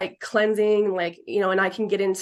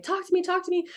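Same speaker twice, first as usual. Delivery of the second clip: higher, eager plea to talk; pleading call; 10 semitones higher, faster than usual. Retold or imitated. Medium in the shower calling to spirit, higher eager plea. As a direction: beg the spirit to speak, pitched up. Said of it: "And I'm like".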